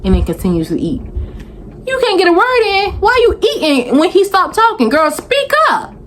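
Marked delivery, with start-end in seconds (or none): 1.9-5.9 much higher, incredulous scolding; exasperated disbelief. Real, not acted. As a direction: urge her to speak up, in disbelief. Scolding the silent wife.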